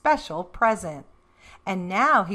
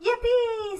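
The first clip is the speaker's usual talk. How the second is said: much higher squeal; excited squeal; 14 semitones higher than usual. A narrator's reading. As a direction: squeal with delight. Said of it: "said Froggy".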